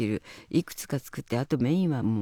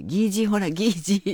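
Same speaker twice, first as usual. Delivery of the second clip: put-on higher, louder teasing to baby; playful baby talk; 8 semitones higher, 5 dB louder than usual. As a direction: tease the baby with the word. Teaching baby "step-grandpa.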